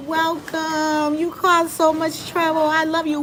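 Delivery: lightly voiced new mom, high and loving; tender mom voice. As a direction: coo it like a mom to her baby. Mother greeting her newborn son.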